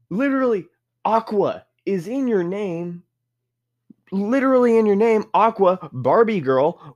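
exasperated. Real, not acted. Ranting Aquarius should be water.

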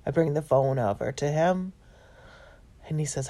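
ordinary talk, nothing marked

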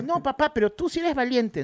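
lightly voiced child challenging dad; imitated daughter. Camila tells dad he is the brave one, imitated daughter.

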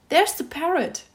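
high, delighted exclamation; excited cry. A narrator's reading. Scrooge sees the parrot from his storybook, crying out excitedly. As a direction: cry out at a sudden happy sighting. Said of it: "cried Scrooge".

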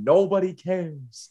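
slow, lightly put-on SpongeBob meme voice; a hint of SpongeBob meme mockery. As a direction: drag it out like the SpongeBob nobody cares meme. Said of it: "that freaking SpongeBob meme".

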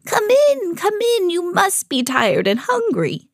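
high, sing-out kindly welcome; kindly old woman. A narrator's reading. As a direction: usher them in sweetly, high-voiced and unhurried at the end. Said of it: "she said kindly".

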